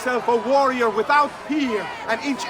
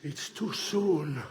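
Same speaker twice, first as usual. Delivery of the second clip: soft, low and slow; dying words; 13 semitones lower, 8 dB quieter, slower than usual. Acted. Dying man protests his death, weakly. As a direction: let the strength drain away.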